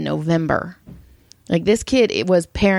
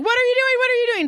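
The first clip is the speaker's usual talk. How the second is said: high-pitched, repeated retold question; alarmed questioning; much faster than usual. Wife catching drunk husband peeing in the hallway, alarmed. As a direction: act out alarm in a much higher voice.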